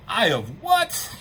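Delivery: drawn-out, rising, incredulous question; slow mock disbelief, swooping up high at the end. Incredulous reaction in retold dialogue.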